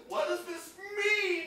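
high, loud teary laugh then a slow awed question; overcome, nearly in tears with joy; emotional euphoria. Retold or imitated. Hiker overcome by the rainbow, teary awe. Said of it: "And then he got emotional".

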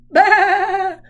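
the mother joins in with a big bah; loud bah. Acted. Mother voting for pizza with a loud bah. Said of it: "said her mother".

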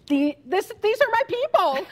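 high, loud joyful cry; excited exclaim. Retold or imitated. Overjoyed at reaching Italy, loud and high. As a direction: burst out with delight at the border. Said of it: "it's like".

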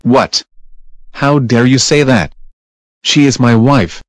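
loud furious yelling; angry shouting. Acted. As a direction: shout it out in rage. Husband shouting about eliminated wife.

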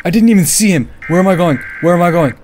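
high, loud, frantic babble; panicked. Real, not acted. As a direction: cry out while fleeing, lost. Running from monster, lost.

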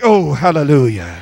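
low, mock-devout swoon; lightly voiced swooning churchwoman. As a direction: pray like a smitten fan, in mild parody. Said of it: "Ladies were like".

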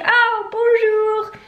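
high, slow sing-song bonjour; overly cheery greeting. Retold or imitated. Greeting the mean guardian, high sugary bonjour. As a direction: sweeten it way up to win him over. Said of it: "I'm like".

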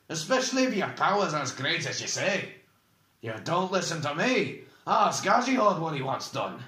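high, forceful exasperated argument; heated challenge. A narrator's reading. Oric arguing that the prisoner cannot escape, heatedly. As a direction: press the point against a stubborn opponent.

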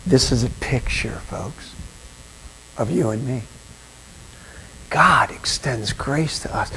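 low, soft, slowing preacher; hushed reflection. Real, not acted. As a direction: drop it down to draw them in. Sermon turns quiet and reflective.

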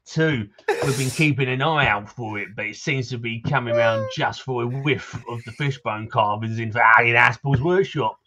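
put-on townsman in a Cockney accent, gruff and chatty; grumbling Cockney local greeting a stranger; Cockney NPC. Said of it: "for the accent compliment".

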